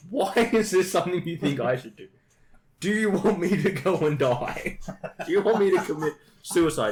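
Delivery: hammed-up exasperation; exasperated protest. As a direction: protest as if mortally affronted. Acted protest at friend's suggestion.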